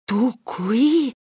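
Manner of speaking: startled question; sudden amazed cry; surprised exclamation. A narrator's reading. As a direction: catch your breath at seeing him. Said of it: "sclamò questi".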